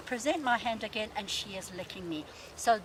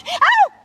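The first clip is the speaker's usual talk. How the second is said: sudden high yelp, much louder; sharp pained yelp; 18 dB louder than usual. Shown as a demonstration. Trainer showing a dog its bite hurt, high loud yelp. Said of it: "I pull my hand away".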